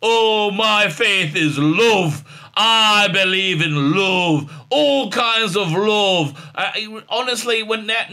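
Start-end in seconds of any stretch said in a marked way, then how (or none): 0.0-6.5 drawn-out, overdone declaration of love; mocking, syrupy slow sermon; mock heartfelt speech. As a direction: stretch it out like a soppy grand speech. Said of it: "speech that says".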